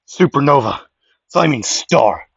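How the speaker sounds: loud anime attack shout; battle cry for the special move; shouted attack call. Acted. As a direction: yell the move name out loud. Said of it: "let me redo that".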